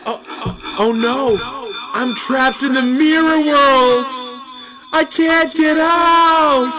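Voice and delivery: loud, slow, high cries of a trapped man; panicked trapped voice. Acted. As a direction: shout for help from behind the mirror. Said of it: "I'm gonna do a little improv bit".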